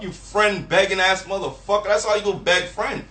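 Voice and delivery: ordinary delivery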